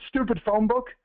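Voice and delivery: mock exasperation, pitched high; frustrated outburst. Man throwing a phone book on the ground, retold frustrated outburst. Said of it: "he gets so frustrated".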